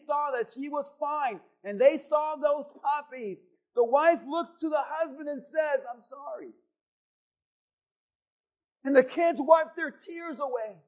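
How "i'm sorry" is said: much softer, contrite; quiet apology. Acted. Wife apologizes to husband at the vet, quietly. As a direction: say it softly, ashamed.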